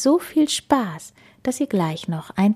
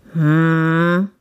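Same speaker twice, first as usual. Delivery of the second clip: louder, long humming rumble; bear's grumble; 5 dB louder than usual. A narrator's reading. Bear considering the hedgehog's remark, long grumble. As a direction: hum it like a pondering bear. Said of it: "brummte der Bär".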